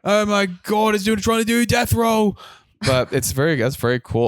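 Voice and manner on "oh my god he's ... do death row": high, drawn-out mock Aussie wildlife-host voice; hammed-up, high-pitched wildlife presenter; imitating Irwin's son. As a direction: mimic an over-excited crocodile hunter, stretching the words. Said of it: "and he's like".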